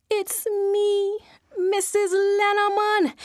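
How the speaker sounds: high, trembling answer to the voice; scared reply. Acted. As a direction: answer it caught and frightened. Wife answers the ghost.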